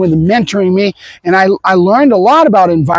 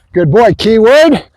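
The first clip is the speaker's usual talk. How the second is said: usual speaking voice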